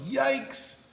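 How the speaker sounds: startled dad's high yelp; hammed-up fright; shocked yikes.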